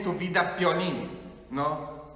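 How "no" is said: ordinary talk, nothing marked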